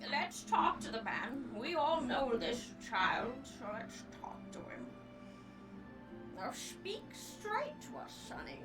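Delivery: lightly voiced old man, slow; an old grandfather asking slowly.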